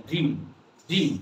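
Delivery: "ড্রিম" with long E and silent A. Shown as a demonstration. Teacher repeats the model word, "ড্রিম" with silent A. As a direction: say "ড্রিম" as one syllable, only the first vowel sounded. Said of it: "আমরা এ এর উচ্চারণ করব না".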